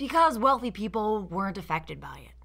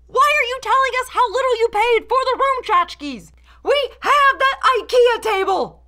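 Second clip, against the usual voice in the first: higher and louder, incredulous yelling; exasperated rant; 8 dB louder than usual. Acted. Reviewer yelling at the hosts over cheap decor, exasperated. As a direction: shout at the screen in disbelief. Critic ranting at the show's hosts.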